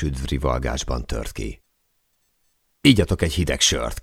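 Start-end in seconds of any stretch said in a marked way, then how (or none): none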